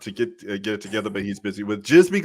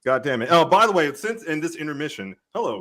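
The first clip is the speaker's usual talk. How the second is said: ordinary delivery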